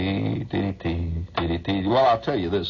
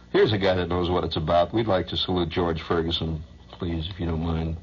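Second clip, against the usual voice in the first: ordinary delivery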